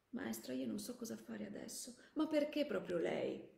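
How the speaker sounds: put-on hushed anguish of a torn young man; helpless distress. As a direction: confide in her, utterly at a loss. Soldier torn before his old teacher.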